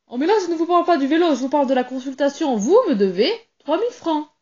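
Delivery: a hint of a greedy doctor pressing for his fee; doctor springing the bill, a touch hurried; pushy doctor.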